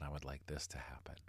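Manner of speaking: fading into a soft whisper. Shown as a demonstration. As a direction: let it trail off to a whisper. Listed wish, fading last take.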